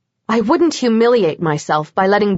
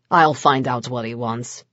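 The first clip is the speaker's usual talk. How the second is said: a lower man's voice, softer; low Vlad voice; 9 semitones lower, 4 dB quieter than usual. A narrator's reading. Vlad reassures Kat before answering the door, low and even. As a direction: keep it low and contained.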